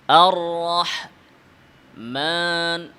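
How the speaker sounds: আর রহমান with only the mim nasal, the alif clear. Shown as a demonstration. Teacher showing where nasal sound belongs, slowly. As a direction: recite রহমান, mim through the nose, alif not. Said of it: "মিমের আওয়াজ নাকে যাবে".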